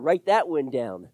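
normal talking voice

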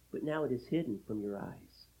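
low, quiet, sorrowful reading; subdued grief. Real, not acted. Preacher reading Jesus' lament over the city, sadly. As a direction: drop down softly in sorrow. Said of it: "I just feel the emotion".

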